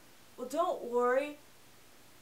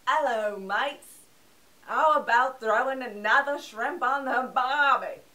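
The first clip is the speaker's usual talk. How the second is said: mocking Australian accent, much louder; bad Australian accent; 9 dB louder than usual. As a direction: ham up a terrible Australian accent like the drummer. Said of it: "It sounded something like this".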